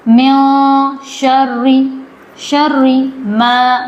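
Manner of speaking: شر with a held doubled r. Shown as a demonstration. Teacher showing students a doubled r, held hard. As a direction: press hard on the r in شر. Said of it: "اس کو سختی سے پڑھا جائے گا".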